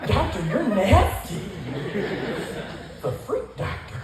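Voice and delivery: slow, high, camp flirting; camp flirty voice. Retold or imitated. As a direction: drawl it out like a sassy tease. Flirty mock to doctor.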